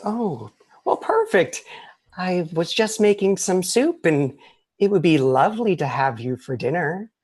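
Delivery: a witch eyeing dinner guests; creepy witch. Acted. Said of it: "And, and Agatha goes".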